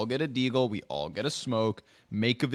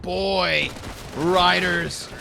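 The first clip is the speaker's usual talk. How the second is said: loud, high, drawn-out caster shout; excited commentary; 7 semitones higher, 8 dB louder, much slower than usual. Real, not acted. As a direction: roar the big play in amazement. Caster shouting at stunning round.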